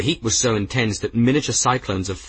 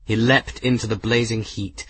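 plain reading voice

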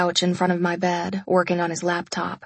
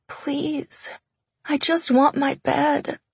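voice breaking; 7 semitones higher, slower than usual. A narrator's reading. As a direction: let the voice crack mid-plea. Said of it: "My voice broke mid-sentence".

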